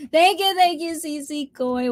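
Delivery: high, lilting thank-you; excited thanks. Real, not acted. Streamer thanking a newly arrived viewer, excited thanks.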